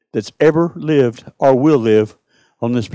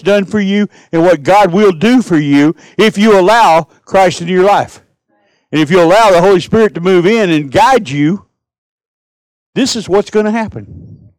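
impassioned preaching; 2 semitones higher than usual. Acted. Preaching at full force.

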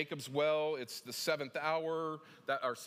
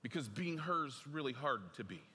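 ordinary delivery

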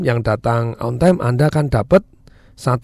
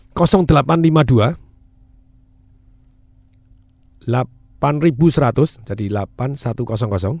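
slowly. Shown as a demonstration. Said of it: "Saya ulangi pelan-pelan".

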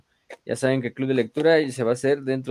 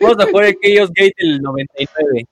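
loud, higher hyped bro shout; frat bro; 8 semitones higher, 12 dB louder than usual. Real, not acted. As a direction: pump everyone up loudly. Hyped bro banter about games.

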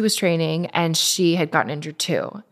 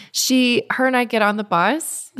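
normal talking voice

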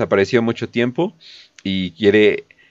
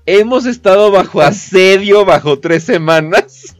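sarcastic slower reread, louder; mocking; 7 semitones higher, 5 dB louder than usual. Shown as a demonstration. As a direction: repeat it, mocking the protesters. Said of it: "Ojo, asedio".

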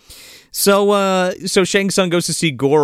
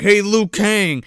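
taunting villain voice; 5 semitones higher, 3 dB louder, slower than usual. Retold or imitated. Voicing Sub-Zero taunting.

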